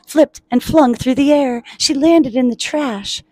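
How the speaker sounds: high, animated picture-book reading; excited storyteller; dramatic story narration. A narrator's reading. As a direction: build the tumble, then stretch the landing.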